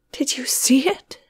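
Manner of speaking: trembling whisper, barely voiced; quivering. A narrator's reading. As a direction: ask in a shaky hush. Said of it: "asked Sarah, quivering".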